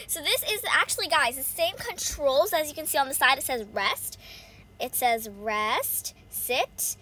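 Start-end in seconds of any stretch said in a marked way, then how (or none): none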